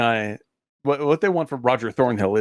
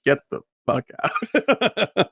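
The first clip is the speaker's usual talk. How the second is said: drawn-out, higher-pitched mock yell; retold angry order; 7 semitones higher, much slower than usual. Man throwing out trespassers who posed as a tour, mock yell. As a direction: stretch the order out high and slow.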